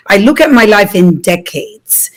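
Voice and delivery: normal talking voice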